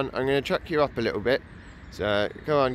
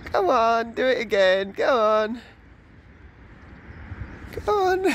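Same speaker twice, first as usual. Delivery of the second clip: high coaxing pet talk; 10 semitones higher, slower than usual. Real, not acted. Coaxing pigeon to fly.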